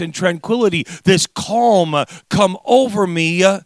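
impassioned, rhythmic sermon delivery; fervent preaching. Real, not acted. Preacher marvelling at undeserved mercy to the congregation, fervent. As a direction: preach it with full conviction.

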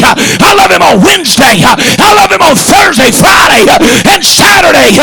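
full-throated revival sermon, every phrase punched out; rhythmic shouted church preaching; fiery preaching. Acted. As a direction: preach it to the back pew with fire.

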